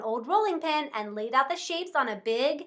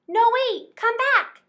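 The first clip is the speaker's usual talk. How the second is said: high, loud call after him; 9 semitones higher, 5 dB louder, slower than usual. Acted. Horse and cow calling after the gingerbread man. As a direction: beg him to come back.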